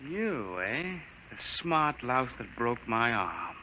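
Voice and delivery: soft, slow gloating threat; lieutenant gloating over the man who broke his arm; quiet menace. Acted. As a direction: savour the revenge quietly.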